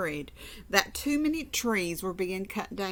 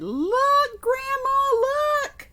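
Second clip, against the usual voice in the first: put-on much higher, louder kid voices calling to Grandma; excited children; 6 dB louder than usual. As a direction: squeal it like kids showing off their tree. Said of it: "and they said".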